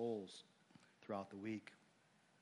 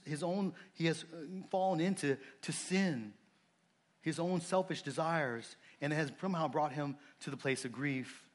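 louder, higher, driving sermon voice; fervent preaching; 6 semitones higher, 7 dB louder than usual. Real, not acted. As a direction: preach it out with urgency. Preaching at full force.

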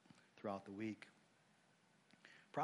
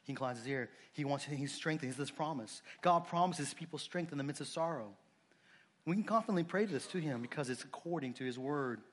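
ordinary talk

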